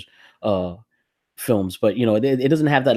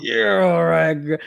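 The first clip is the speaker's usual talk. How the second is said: mock quote; 8 semitones higher, 4 dB louder, slower than usual. Repeating the quote.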